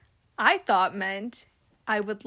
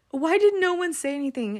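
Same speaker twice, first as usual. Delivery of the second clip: indignant disbelief; 7 semitones higher, 5 dB louder than usual. Retold or imitated. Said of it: "I was like".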